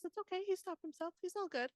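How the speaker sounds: mock reassurance. Real, not acted. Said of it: "mock reassurance".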